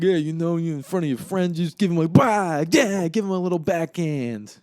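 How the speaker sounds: loud, high, hammed-up tearful rant; mock weepy wailing, building in force; Paulie through tears. Imitating Paulie's tearful rant to Charlie, loud and high. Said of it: "Just, like, through tears".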